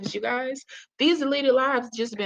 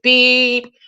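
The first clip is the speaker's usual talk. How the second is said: loud, held monotone beep; one long electronic-style "beep"; imitated dryer beep; 9 dB louder than usual. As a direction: mimic an appliance buzzer with your voice. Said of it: "Y'all hear the dryer said".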